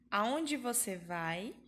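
ordinary talk

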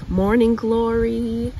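normal talking voice

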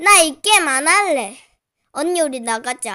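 ordinary delivery

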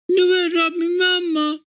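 put-on high, near-tears child's plea; on the verge of crying; trembling voice. A narrator's reading. Gloria asking her mother again, trembling near tears. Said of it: "con voce tremante ripeté".

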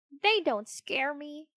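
high, slow, huffy puppet; dismissive sniff. A narrator's reading. Pinocchio to cricket before stomping off, sniffy and high. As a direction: brush off the warning with a sniff. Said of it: "sniffed Pinocchio".

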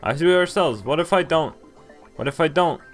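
ordinary talk, nothing marked

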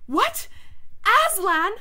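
high, loud queen's outburst; startled, shouted exclamation; shocked cry. A narrator's reading. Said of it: "cried the queen".

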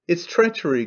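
ordinary delivery, nothing marked

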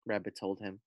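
ordinary delivery, nothing marked

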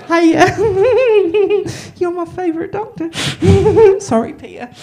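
high, loud, half-laughing fangirl squeal; giddy fan greeting broken by laughs; starstruck gushing. Retold or imitated. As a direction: gush at your hero through laughter. Starstruck gushing at David Tennant.

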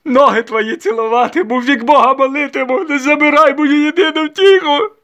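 slow, very high, loud lament; tearful wailing. A narrator's reading. Mother begging for her only joy, crying out. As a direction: wail and beg as if crying. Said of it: "плакала Докія".

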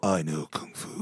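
low, soft, awed film quote; quiet mock-serious movie voice; movie-line imitation. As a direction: drop low and hushed for the quote. Echoing a famous movie line.

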